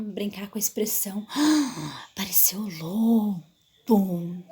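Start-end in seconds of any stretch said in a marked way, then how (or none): 1.3-2.1 loud startled gasp; storyteller's suspense gasp; dramatic gasp. Shown as a demonstration. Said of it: "Brincar com a voz". 2.1-4.4 slow, ominous reveal; storyteller teasing the wolf's arrival; suspenseful storyteller. Shown as a demonstration. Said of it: "Brincar com a voz, com a entonação".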